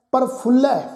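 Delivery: ਪ੍ਰਫੁੱਲੈ with a doubled, held ਫ sound; pronunciation demo. Shown as a demonstration. Teacher showing the congregation how ਪ੍ਰਫੁੱਲੈ is said. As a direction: say ਪ੍ਰਫੁੱਲੈ as pər-pʰul-lɛ, stressing the doubled l. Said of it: "ਫੱਫੇ ਦੇ ਪੈਰ 'ਚ ਔਂਕੜ ਉੱਪਰ ਅਧਿਕ ਲਗਾ ਕੇ ਉਚਾਰਨ".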